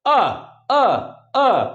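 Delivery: अ as a short open a, repeated; short a sound. Shown as a demonstration. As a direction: drill the vowel अ. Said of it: "केवल sound क्या होगी?".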